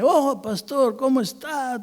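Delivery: put-on high-pitched, effusive drunk greeting; talkative drunk. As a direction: play a chatty drunk hailing the pastor. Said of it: "vino todo hablador ... borracho".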